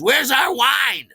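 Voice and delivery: loud, high, drawn-out holler; big hammed-up restaurant yell; demanding shout. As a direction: bellow it like an impatient customer. Diners demand wine, retold.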